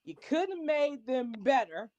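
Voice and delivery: ordinary talk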